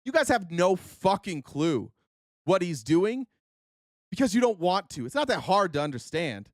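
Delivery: ordinary talk, nothing marked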